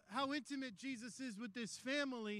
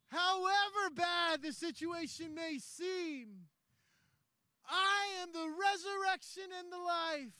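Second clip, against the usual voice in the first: impassioned preaching; 6 semitones higher, 7 dB louder than usual. Acted. Preacher proclaiming resurrection to the congregation, impassioned and building to a shout. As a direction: preach it at full force, slowing on the big lines.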